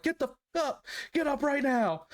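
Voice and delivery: high-pitched mock yelling; retold yell. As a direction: sergeant yelling at him to get up, imitated high. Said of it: "And just yelling at me".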